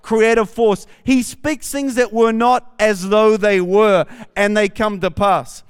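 loud, building preacher's cadence; preaching. Acted. Pastor preaching to the church, loud and building. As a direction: preach it with rising force over the congregation.

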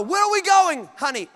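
high, loud mock-wifely demand; exasperated questioning. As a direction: play a wife demanding an answer. Sarah questions her husband.

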